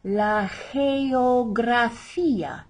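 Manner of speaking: slow "geografía", first G like an H, hard "gra"; soft first G. Shown as a demonstration. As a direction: model "geografía" as heh-o-gra-FEE-a. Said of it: "we have a soft G".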